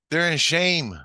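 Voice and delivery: high, loud answer; emphatic pronouncement. Retold or imitated. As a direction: declare it forcefully from on high. Answers the agitated question firmly.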